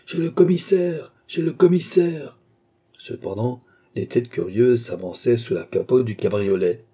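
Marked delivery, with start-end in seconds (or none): 0.0-2.4 high, raised chanting cry; crowd clamour. A narrator's reading. Thousand voices repeating the cry, high crowd clamour. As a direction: call it out like a mob taking up a chant. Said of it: "mille voix de répéter".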